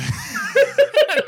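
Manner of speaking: high, loud laugh bursting out; real helpless laughter. Real, not acted. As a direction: crack up at the silly line. Laughing at movie line.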